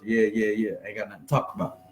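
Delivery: dismissive amateur. Acted. Amateur answers with statements and no questions, dismissive. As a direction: drop your pitch well down, imitating someone with nothing to say. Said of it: "amateurs just statements and rebuttals".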